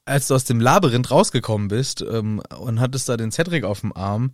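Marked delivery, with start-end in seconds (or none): 0.6-1.1 "Labyrinth" punched on the opening LA; stress first syllable. Shown as a demonstration. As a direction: lean hard on LA in "Labyrinth". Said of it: "die betonen immer die erste Silbe". („Labyrinth“ vorne auf LA betont)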